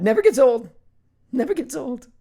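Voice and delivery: high, silly put-on voice; goofy cartoon voice. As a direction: squeal it like a goofy cartoon fan. Goofy quote about Olaf.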